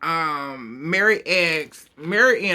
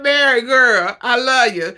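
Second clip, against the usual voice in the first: louder, higher cry of love; loud gushing; 5 semitones higher, 6 dB louder than usual. Real, not acted. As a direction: shout your love at the screen. Gushing at Mary on screen.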